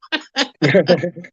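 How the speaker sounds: high, helpless laugh; real burst of laughter. Real, not acted. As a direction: lose it at the punchline. Laughing at own story.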